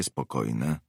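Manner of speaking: ordinary delivery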